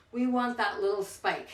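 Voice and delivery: ordinary talk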